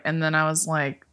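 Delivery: usual speaking voice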